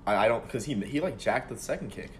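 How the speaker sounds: ordinary talk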